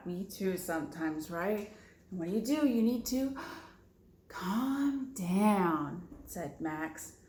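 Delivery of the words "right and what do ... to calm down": usual speaking voice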